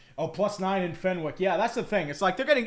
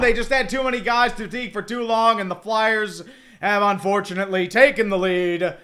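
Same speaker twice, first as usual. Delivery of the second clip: steady broadcaster call, rising louder as the play heats up; live play-by-play; 5 dB louder than usual. Acted. Streamer calling the Flyers-Penguins shift for his viewers, announcer play-by-play. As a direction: narrate every pass like a TV announcer, building to the goal.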